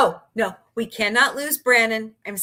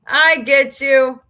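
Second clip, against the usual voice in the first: louder, slow, drawn-out "I get you"; emphatic repeat; 4 semitones higher, 9 dB louder, much slower than usual. Shown as a demonstration. Reassuring a chatter she understands, slow loud repeat.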